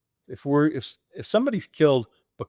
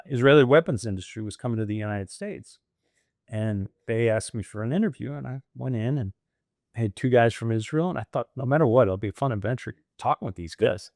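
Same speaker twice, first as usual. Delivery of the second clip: ordinary talk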